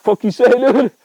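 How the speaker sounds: angry rooster shout. Pretend. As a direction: yell it like a bird about to attack. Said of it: "He's like".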